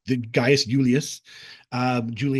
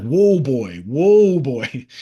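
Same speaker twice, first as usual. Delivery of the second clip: whoa called out louder, like to a spooked horse; crowd calming him; 7 dB louder, much slower than usual. Retold or imitated. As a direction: soothe him like a skittish horse, raised voice. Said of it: "everyone's like".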